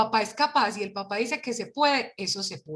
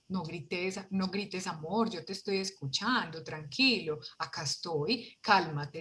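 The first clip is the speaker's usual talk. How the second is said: soft, lower, reassuring voice; tender and hushed, acted as the better way; calm soothing parent; 4 semitones lower, 7 dB quieter, slower than usual. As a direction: gently settle an upset child. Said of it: "Vamos a intentarlo de otra manera".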